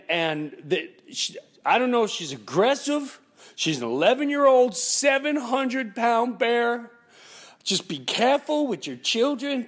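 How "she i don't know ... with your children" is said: high, loud impression of a smoker neighbor; smoker's voice. Neighbor warns about children and the bear, smoker's voice. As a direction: keep up the pitched-up neighbor imitation. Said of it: "She had that smoker's deep voice".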